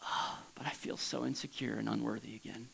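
very soft, low, deflated sigh; acted dejection, hushed and low; insecure. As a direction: sink into quiet self-doubt. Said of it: "do you ever feel that way?".

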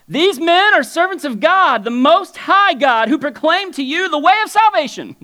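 hammed-up girl crying out praise. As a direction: cry it out like a possessed girl announcing. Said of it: "cries out".

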